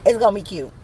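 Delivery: ordinary delivery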